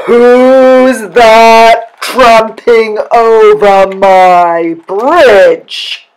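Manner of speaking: very loud, slow roar; troll's loudest roar. A narrator's reading. Troll challenges the biggest goat, roaring at full voice. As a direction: bellow every word, drawn out. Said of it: "in his loudest voice".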